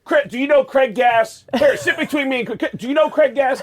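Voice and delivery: imitating Belzer summoning Chris to sit; Richard Belzer impression. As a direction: wave him over like a pushy pal. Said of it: "and he looks up and goes".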